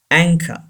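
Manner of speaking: anchor as "ANG-ka", no R at the end; anchor, stressed first, ending in "ka"; silent final R. Shown as a demonstration. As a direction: say anchor with CH as k and drop the R. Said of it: "R is not pronounced".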